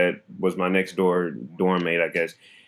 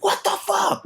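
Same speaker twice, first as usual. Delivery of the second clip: frustrated outburst; slower than usual. Retold or imitated. Frustrated in hallway.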